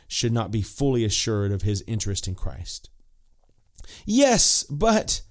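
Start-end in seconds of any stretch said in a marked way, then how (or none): 4.1-5.2 high, raised protesting objector. Acted. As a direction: cut in like a doubting objector. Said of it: "says another".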